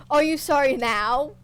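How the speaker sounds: loud mocking taunt. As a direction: jeer at him cruelly. Torturer taunts captive.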